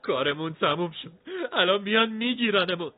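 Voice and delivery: high, whining wail on the verge of crying; near-tears whimper. A narrator's reading. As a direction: moan in despair, almost sobbing.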